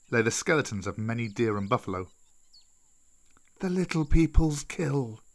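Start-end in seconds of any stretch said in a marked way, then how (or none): 3.6-5.2 slow, drawn-out serpent voice; old snake Kaa. Acted. Old snake reminds Mowgli of the pack, slow and drawn-out. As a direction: caution slowly as an old serpent. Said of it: "said Kaa".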